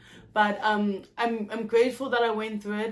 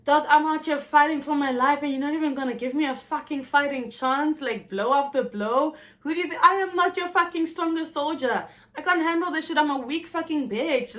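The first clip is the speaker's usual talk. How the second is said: loud, higher ranting anger; angry at God; 5 semitones higher, 4 dB louder than usual. Retold or imitated. Yelling at God in bed, furious rant. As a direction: rail at God with fury. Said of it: "angry at the Lord".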